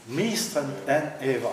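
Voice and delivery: slow "Mistrant en Eva", accent hit on "Mistrant"; demonstrating stressed reading. Shown as a demonstration. Showing colleagues the verse scans, slow and stressed. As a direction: say "Mistrant en Eva" slowly, stress the first syllable. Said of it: "Regardez, ça marche bien".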